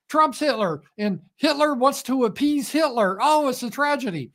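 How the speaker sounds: high, exaggerated mocking impression; Kamala Harris. Host channeling a politician calling Putin Hitler, mocking impression. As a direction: pitch it way up, mock simple outrage. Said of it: "I'm channeling my Kamala Harris".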